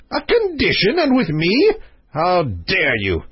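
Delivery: high outraged king, slowing and dropping at the end; indignant exclamation. Acted. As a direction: exclaim like an affronted monarch. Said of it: "exclaimed the king".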